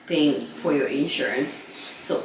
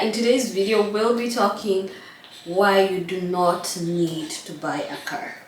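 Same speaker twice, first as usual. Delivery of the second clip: normal talking voice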